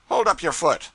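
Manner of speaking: high, loud gruff command; curt order. A narrator's reading. Pilot ordering the cub to show his shoe, loud and high. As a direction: snap the order at him.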